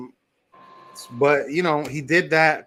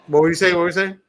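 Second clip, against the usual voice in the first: louder, higher, cutting in; loud interruption; 5 semitones higher, 7 dB louder, much faster than usual. Real, not acted. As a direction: raise voice to break in. Cuts in, calling over.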